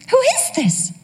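put-on high, puzzled old mom; confused elderly mother. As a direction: ask it like a lost old lady. Mother voiced on phone.

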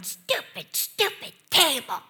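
put-on high, cross little-kid voice; three-year-old daughter. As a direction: imitate a toddler cursing the furniture. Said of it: "she was going".

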